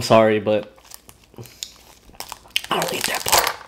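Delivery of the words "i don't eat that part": whispering. Real, not acted. Aside while disgusted.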